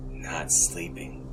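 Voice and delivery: plain reading voice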